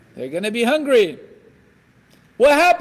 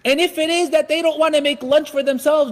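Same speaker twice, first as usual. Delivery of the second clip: ordinary talk, nothing marked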